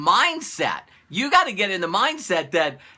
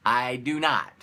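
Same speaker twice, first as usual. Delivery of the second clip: lower flat refusal; 7 semitones lower, 3 dB quieter than usual. Retold or imitated. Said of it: "I don't look at workout as fun".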